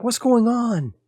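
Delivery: high-pitched startled cry; alarmed call. Acted. Devin runs into the mine to screaming and gunfire, alarmed call.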